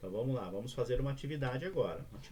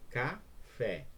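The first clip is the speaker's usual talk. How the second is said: "café" as two separate syllables. Shown as a demonstration. Teacher demonstrating a two-syllable word, syllable by syllable. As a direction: say "café" as ca, pause, fé. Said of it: "duas sílabas".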